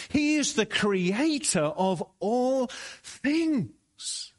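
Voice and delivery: preaching from the pulpit, rising to a climax; full-force preaching. Acted. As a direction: lift the congregation with a soaring proclamation. Preacher rises to exalt Jesus.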